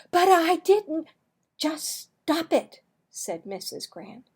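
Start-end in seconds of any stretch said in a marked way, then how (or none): 0.1-1.1 high, loud indignant protest. Acted. Marvin protests to the lunchroom supervisor, high and indignant. 1.6-2.8 stern order. Acted. Supervisor scolding Marvin.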